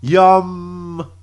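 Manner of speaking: Yum. with the M hummed long, high and loud; hammed-up Yum. with a drawn-out M; stress on the M's. Shown as a demonstration. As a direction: lean into the M of Yum. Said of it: "Emphasis on the M's".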